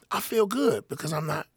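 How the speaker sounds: high-pitched mock protest; protesting self-defence. Comedian retelling his reply to an upset man, high protesting. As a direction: plead your case, pitch climbing high.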